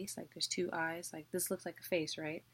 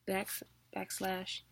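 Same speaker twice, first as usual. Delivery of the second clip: ordinary talk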